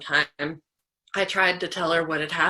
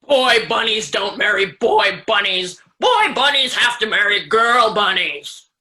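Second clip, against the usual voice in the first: loud, higher, bossy creature voice; scary stink bug voice; 4 semitones higher, 5 dB louder than usual. Acted. Stink bug lecturing the bunnies, loud bossy scary voice. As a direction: lord it over them with a loud pompous bug voice. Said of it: "a scary voice".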